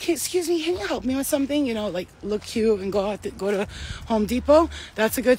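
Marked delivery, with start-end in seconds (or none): 0.0-1.7 high, sweet damsel voice asking for help; pitched-up innocent request for help; sweet flirty ask. Pretend. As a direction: play helpless and cute to a handsome stranger. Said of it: "I'll be like".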